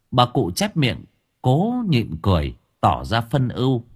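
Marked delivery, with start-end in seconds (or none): none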